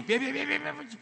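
high, loud rush of protest; panicked stopping. Acted. Doctor hastily halting the nurses, high and flustered. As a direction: frantically wave them off.

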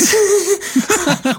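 squealing laugh; high burst of laughter. Real, not acted. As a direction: laugh in disbelief. Laughing at the plot.

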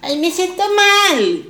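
high, slow, whiny mock lament; mock whiny friend. Mocking a friend who texts to complain, whiny. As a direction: whimper it like a needy friend.

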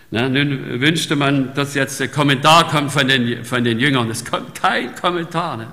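fervent sermon voice; forceful preaching. Acted. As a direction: preach it out to the congregation.

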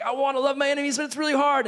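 high, strained little complaint; whiny believer. Retold or imitated. Preacher voicing a struggling believer, high whine.

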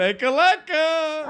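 silly high voice. Acted. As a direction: stretch lecker, lecker up high and slow. Repeats with put-on voice.

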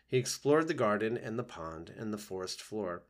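ordinary delivery

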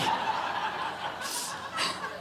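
silent laugh. Real, not acted. Breaks up laughing.

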